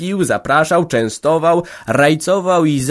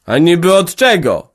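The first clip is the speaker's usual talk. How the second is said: loud, high challenge; man from Wola shouting a challenge; angry shout; 6 semitones higher, 5 dB louder than usual. A narrator's reading. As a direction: yell it back, jumping up. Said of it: "wrzasnął ten z Woli".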